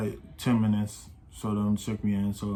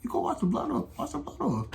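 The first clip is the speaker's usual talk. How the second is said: much higher squeaky imitation of himself; mock high girly voice; 4 dB quieter than usual. Telling the friend to wash off blood, squeaky high mock voice. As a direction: pitch way up for a hammed-up quote. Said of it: "I said, Girl".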